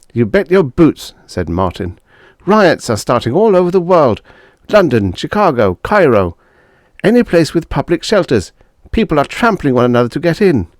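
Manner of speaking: officer warning neighbours of riots; urgent officer. Acted. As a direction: rattle off the bad news.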